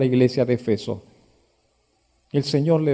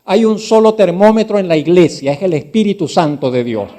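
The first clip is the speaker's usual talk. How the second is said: loud, high preacher's warning; preaching at full force; 4 semitones higher, 9 dB louder than usual. Acted. Pastor warning the church against judging, preaching loudly. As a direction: cry it out over the congregation.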